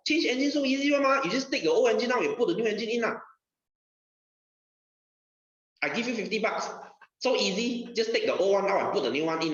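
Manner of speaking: high-pitched, haggling customer griping at a mechanic; complaining car customer. Acted. Customer telling the mechanic it's an easy engine swap, high-pitched complaint. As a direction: pitch it up, grumbling that the job is easy. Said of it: "You go and tell the mechanic".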